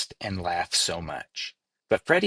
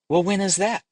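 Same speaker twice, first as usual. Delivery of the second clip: put-on high, urgent child question; anxious young leaf; 10 semitones higher, 4 dB louder than usual. A narrator's reading. Freddy presses Daniel about dying, high and anxious. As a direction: push the question up high, worried.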